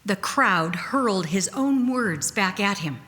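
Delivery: plain reading voice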